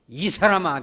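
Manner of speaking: loud slow angry snap; angry outburst. Retold or imitated. Peter angrily denying Jesus, loud outburst. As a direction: bark the denial in panic. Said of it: "막 화를 버럭 냅니다".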